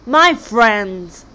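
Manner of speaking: booming loud call to his people; roaring king. Acted. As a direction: roar it out to the whole tribe. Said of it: "roared the king".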